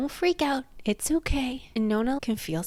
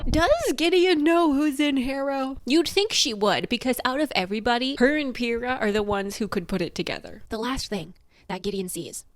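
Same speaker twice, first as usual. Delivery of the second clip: ordinary talk, nothing marked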